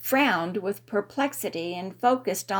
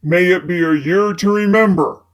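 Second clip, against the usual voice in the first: raised male voice over a crowd; loud toast; 4 semitones lower, 10 dB louder than usual. A narrator's reading. Fitz toasts loudly.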